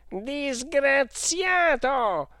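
high, long-drawn outraged yell; angry shout. Acted. Clodoveo finding the boy who pulled the alarm, shouting. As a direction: stretch the insult into a shout. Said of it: "gridò il signor Clodoveo".

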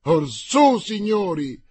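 loud, rising call to the men; rallying shout. A narrator's reading. The king rallies his men, shouting. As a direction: rouse the gentlemen to battle. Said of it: "gridò il re".

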